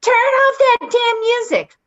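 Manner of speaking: high, loud mock scream of an old woman; shrill-free but loud imitation of her yelling; retold screaming. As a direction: mimic the old lady yelling at night. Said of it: "and she's screaming".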